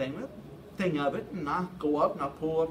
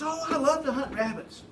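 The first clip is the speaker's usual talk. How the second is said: higher-pitched, mock enthusiasm; lightly hammed-up delight; eager reply; 7 semitones higher than usual. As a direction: play an eager friend jumping at the offer. Acted eager reply to hunting invitation.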